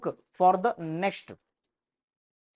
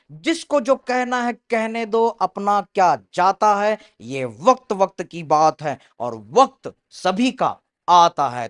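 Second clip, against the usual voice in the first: loud, rousing recital of a Hindi couplet; shayari recitation; 8 dB louder, faster than usual. Acted. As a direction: declaim the shayari to lift the students. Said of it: "चलो एक शायरी हो जाए".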